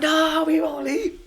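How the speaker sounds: high, drawn-out group answer; mock crowd reply, laughing. Crowd promising the band to stay, high mock chorus with laughter. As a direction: imitate a crowd, stretched out and laughing.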